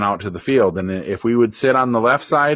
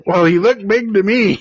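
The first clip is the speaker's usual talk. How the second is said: higher, lightly voiced boy making excuses; sheepish kid; 10 semitones higher, 4 dB louder than usual. Son excusing himself to his dad, lightly voiced sheepish kid. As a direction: answer like a boy caught out. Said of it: "he says".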